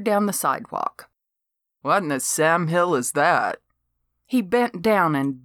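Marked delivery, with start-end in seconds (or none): 1.8-3.6 lightly voiced skeptical neighbor; lightly voiced Harry.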